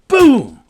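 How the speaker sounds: loud high shouted boom; big shouted impact sound; knockout boom. Retold or imitated. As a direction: shout the boom like the final punch landing. Imitating knockout punch.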